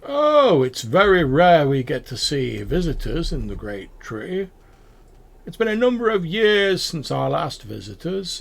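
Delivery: high, loud delighted welcome; delighted greeting. Acted. Tree-dweller welcoming rare travelers, high and delighted. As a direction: gush over the rare visitors.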